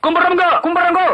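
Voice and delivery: quick, high-pitched salutation; eager greeting. Acted. Local man greeting a wealthy visitor, eager high greeting.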